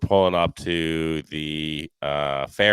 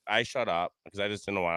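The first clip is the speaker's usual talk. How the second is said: ordinary talk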